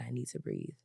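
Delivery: calming herself down. Real, not acted. To the listeners while calming her anger, hushed and low. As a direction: talk yourself down, barely above a breath. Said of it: "I have to calm myself".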